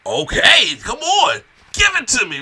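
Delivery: high, loud mock-excited shout; imitated excitement. Imitating Lesnar in his chair, excited high shouting. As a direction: whoop it up as an eager fighter egging someone on. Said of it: "I'm excited".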